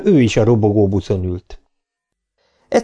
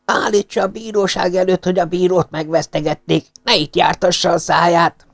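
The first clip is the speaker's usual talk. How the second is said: growling rebuke; 8 semitones higher than usual. A narrator's reading. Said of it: "rámordult az öreg úrra".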